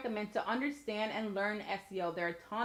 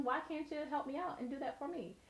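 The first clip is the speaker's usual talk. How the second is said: soft, higher coaxing voice; playful plea; 5 semitones higher, 8 dB quieter than usual. Real, not acted. Asking viewers to help out with likes and comments, playful plea. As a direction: coax sweetly, higher and softer on the request.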